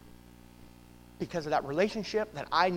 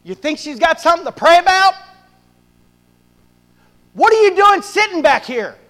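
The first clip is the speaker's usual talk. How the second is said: much higher, louder rebuking God; God yelling; 10 semitones higher, 16 dB louder than usual. Retold or imitated. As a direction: shout it down at him like God scolding. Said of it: "He yells at me".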